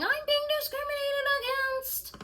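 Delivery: high mock whiny claim; mocking the man. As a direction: parody his complaint. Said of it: "and saying".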